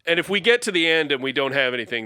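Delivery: normal talking voice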